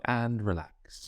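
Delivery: slow, stretched mock sigh of relief; drawn-out easing off. Rower easing off at two fifty in, drawn-out. Acting out easing off mid-race.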